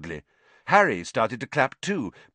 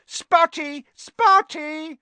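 very high, loud jeer; shrieked playground mockery; yelling taunt; 6 dB louder, much slower than usual. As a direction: yell the nickname after someone fleeing.

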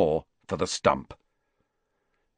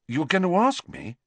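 higher, softened disbelief; changed, surprised tone; 8 semitones higher than usual. A narrator's reading. Boy realising she meant to invite him, taken aback. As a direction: drop the scorn and ask in surprise. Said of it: "in a completely different voice".

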